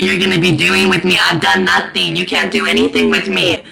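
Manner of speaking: loud, low, outraged protest; indignant protest. Retold or imitated. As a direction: push back hard at the police. Protesting to the police.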